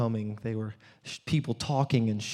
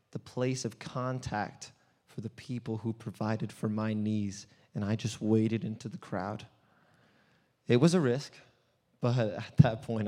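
ordinary talk, nothing marked

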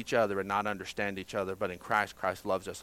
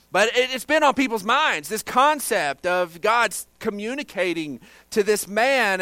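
loud, high, drawn-out preaching; pressing key words out to the congregation; forceful preaching; 8 semitones higher, 9 dB louder than usual. Acted.

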